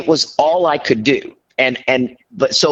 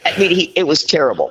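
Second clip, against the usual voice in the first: ordinary talk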